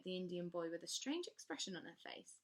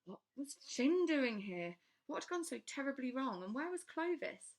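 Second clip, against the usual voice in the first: alarmed narration; 7 semitones higher, 7 dB louder than usual. A narrator's reading. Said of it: "moan of despair".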